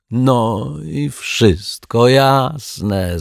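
high, very drawn-out jovial cry; exclaiming. A narrator's reading. Rogue rises to embrace the detective, exclaiming jovially. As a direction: sing out with open-armed relief. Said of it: "zawołał".

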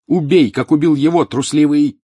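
high, loud furious shout. A narrator's reading. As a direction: scream defiance at him. Harry defying Snape.

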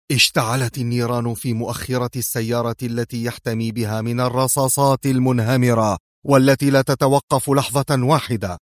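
plain reading voice

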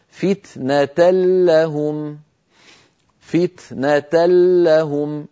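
slow recitation of "فتنة لهم", the tanween merged into a doubled lam; recited with idgham. Shown as a demonstration. Teacher reciting the tanween example slowly with merged nun. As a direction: say "فتنة لهم" as "fitnatal-lahum", no n sound. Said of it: "فتصبح بعد الإدغام هكذا".

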